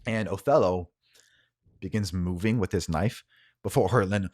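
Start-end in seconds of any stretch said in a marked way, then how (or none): none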